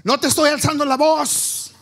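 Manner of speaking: yelling that he's not yelling at his wife; shouting denial. Acted. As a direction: bellow the denial, ironically loud. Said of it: "el esposo dice".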